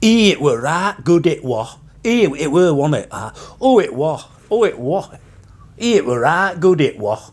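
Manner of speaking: exaggerated West Yorkshire accent, pitched high then dropping; mock West Yorkshire accent. Vlogger sending up West Riding locals, mock West Yorkshire accent. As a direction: send up broad West Yorkshire speech. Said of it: "'Cause they sort of go".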